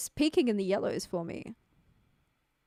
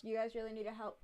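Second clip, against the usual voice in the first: much softer, offhand aside; talking away from stage; 11 dB quieter, faster than usual. Retold or imitated. Singer asking crowd for help while walking off, tossed away quietly. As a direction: trail off as if wandering away from the mic. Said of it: "he wouldn't be, like, talking to the stage".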